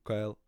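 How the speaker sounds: "Caol" said carefully as an Irish word; loud Irish "Caol", close to "kayl"; Irish pronunciation. Shown as a demonstration. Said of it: "is the Irish word for slender".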